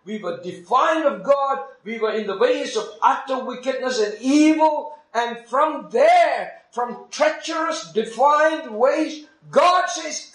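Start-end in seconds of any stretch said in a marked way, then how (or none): 2.1-10.3 climbing higher and slower, building to a peak; mounting preacher's fervour; rising fervour. Real, not acted. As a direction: preach it up toward the call. Preaching builds to God's call.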